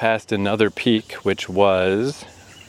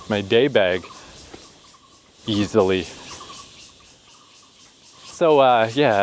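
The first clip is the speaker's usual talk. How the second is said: usual speaking voice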